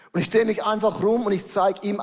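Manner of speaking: usual speaking voice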